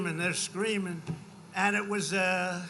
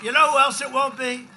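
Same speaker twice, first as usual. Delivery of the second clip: rally shout; 4 semitones higher, 8 dB louder than usual. Real, not acted. Rally build-up to a punchline.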